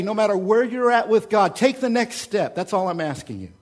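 loud, urgent challenge from the pulpit; rally them to take the next step; driving sermon call; full-force preaching. Acted.

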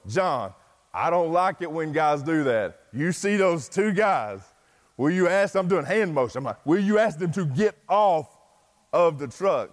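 repeating so a non-native speaker gets it. Retold or imitated. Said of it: "I repeated it to him".

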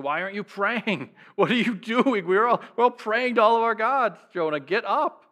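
a ship's captain shaking Jonah awake in alarm; exasperated captain. Retold or imitated. Preacher voicing the captain rousing Jonah, exasperated. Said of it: "The captain said".

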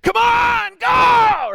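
high, loud cheering yell; screaming dad on the sideline. Retold or imitated. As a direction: yell your kid down the field. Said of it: "screaming my crazy head off".

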